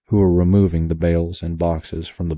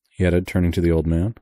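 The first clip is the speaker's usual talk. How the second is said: usual speaking voice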